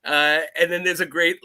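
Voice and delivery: normal talking voice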